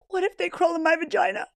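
high, panicked whine; mock panic. Pleading with sleepy husband, high mock panic. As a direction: voice jumps up in hammed-up alarm.

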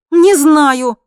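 high, loud irritated retort; exasperated yelp; snapping back. A narrator's reading. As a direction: snap it back sharply. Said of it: "огрызнулась женщина".

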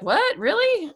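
high incredulous squeak; doubting surprise. Retold or imitated. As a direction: jump way up in disbelief. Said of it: "some people are like".

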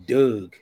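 "dog" said as "Doug", uh vowel; Baltimore "Doug". Shown as a demonstration. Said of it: "that's what it's saying".

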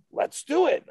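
high, loud, eager burst; eager agreement. Retold or imitated. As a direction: jump at the idea, pitch way up. Retold star agrees eagerly.

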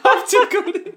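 high-pitched giggling through words; can barely speak for laughing; helpless laughter. Real, not acted. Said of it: "Oh God!".

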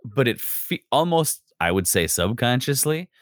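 ordinary talk

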